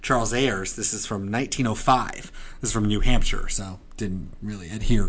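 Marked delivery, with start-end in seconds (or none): none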